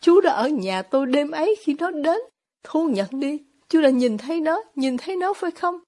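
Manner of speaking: ordinary delivery, nothing marked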